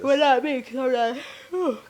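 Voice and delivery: talking through a yawn; words stretched by a yawn. Real, not acted. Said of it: "Excuse my yawn".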